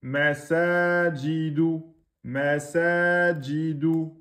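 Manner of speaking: masajidu slowly, the long a held exactly two beats; two-beat madd. Shown as a demonstration. Teacher models the word for students, slowly. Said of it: "deux temps, pas plus".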